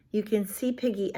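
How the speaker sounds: ordinary delivery, nothing marked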